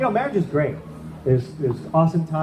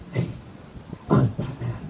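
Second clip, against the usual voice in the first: Batman voice; 2 semitones lower, 5 dB quieter than usual. Retold or imitated. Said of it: "you sound a bit like a Joker".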